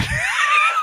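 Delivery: sudden high shrieking laugh. Real, not acted. Laughing hard at his own strip club story.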